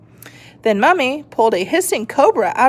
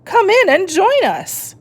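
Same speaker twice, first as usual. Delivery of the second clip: high, louder witch greeting; 11 semitones higher, 4 dB louder than usual. A narrator's reading. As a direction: squeak it like a witch.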